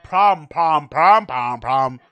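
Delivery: mocking repeated "prom". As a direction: rattle off "prom, prom" to mock him. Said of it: "He's lazy and doesn't want to finish words".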